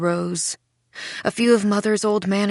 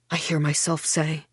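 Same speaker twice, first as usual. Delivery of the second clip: plain reading voice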